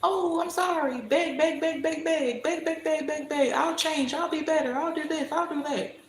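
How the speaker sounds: high whiny begging voice; mock begging. Mocking her ex's pleading, high and whiny. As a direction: whine like a groveling ex. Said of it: "what he wanted me to do".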